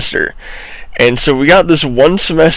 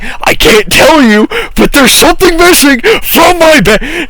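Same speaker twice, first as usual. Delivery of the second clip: mock yell; 13 semitones higher, 4 dB louder than usual. Classmate complains to teacher.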